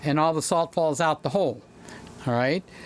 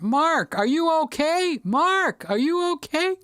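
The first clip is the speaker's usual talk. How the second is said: imitating a freaked-out parrot; 10 semitones higher than usual. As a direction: mimic the bird freaking out. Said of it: "Louis is still freaking out".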